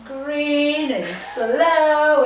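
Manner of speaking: long, loud, elongated "slow"; slow, stretched vowel for emphasis; drawn-out slow. Shown as a demonstration. Drawing out slow to show it.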